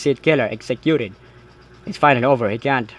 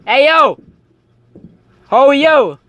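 calling out; 13 semitones higher, 8 dB louder than usual. Real, not acted. Calling out to a stranger.

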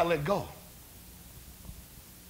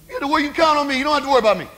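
raised, loud pledge of loyalty; preacher playing an overconfident disciple; insistent assurance; 9 semitones higher, 8 dB louder, much faster than usual. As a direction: push the reassurance hard, voice high. Peter boasts of his loyalty.

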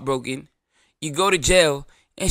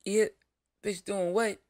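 soft, casual, mildly incredulous; unbothered phone reply; 4 semitones higher, 7 dB quieter than usual. Pretend. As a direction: shrug it off on the phone. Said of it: "I'd be".

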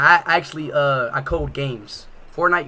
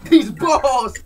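laughing; 10 semitones higher, 5 dB louder than usual. Acted.

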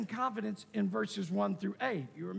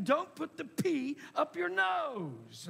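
slow, higher-pitched parental warning; parent scolding; 6 semitones higher, slower than usual. Acted. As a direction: warn a small child, drawing it out.

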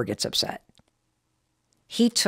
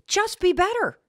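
retold scream; 11 semitones higher than usual. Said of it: "Chris did scream at me".

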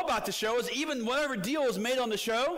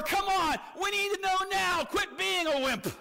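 mock goading; 10 semitones higher than usual. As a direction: push and taunt as an impatient bully would. Mimics friends bullying into snap decision.

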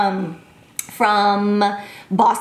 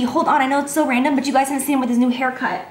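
normal talking voice

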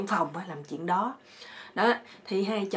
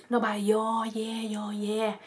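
slow, drawn-out monotone drone; droning insect hum; buzzing mosquito imitation; 2 semitones higher, slower than usual. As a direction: stretch the words into a mosquito's whine. Imitating mosquitoes buzzing round the sleeper.

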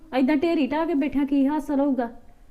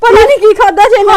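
panicked shout; 8 semitones higher, 14 dB louder than usual. Acted.

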